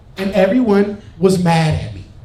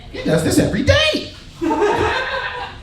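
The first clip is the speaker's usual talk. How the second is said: high-pitched awe; 3 dB quieter, slower than usual. Acted. Awed disbelief at video.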